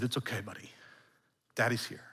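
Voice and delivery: put-on calm a crying child in the dark; soothing whisper-soft dad. Dad soothing crying toddler at night.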